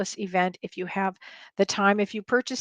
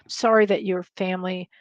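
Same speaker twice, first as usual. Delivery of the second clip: ordinary talk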